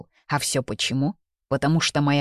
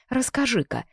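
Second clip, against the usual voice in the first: a higher, mocking jab; tossed off; 8 semitones higher than usual. A narrator's reading. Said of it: "бросила я". (насмешливо бросила)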